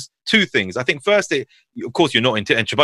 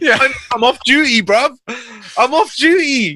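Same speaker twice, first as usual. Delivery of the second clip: high, loud comic protest; mock protest; 12 semitones higher, 4 dB louder, slower than usual. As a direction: fend off a fan with hammed-up indignation. Acted protest to fans, joking.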